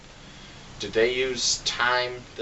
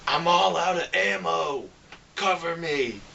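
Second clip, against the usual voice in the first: higher, mock talking-toy line; like pressing a toy's voice button; toy robot voice; 4 semitones higher than usual. Reviewer mocking John Goodman's character, talking-toy voice. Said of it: "And then he's like".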